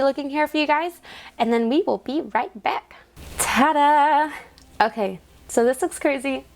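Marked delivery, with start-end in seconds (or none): 3.4-4.3 high, bright-spirited presenting flourish; showy reveal. Real, not acted. Vlogger reveals the tidied money to viewers, showy flourish. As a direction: present it proudly, high and sung out.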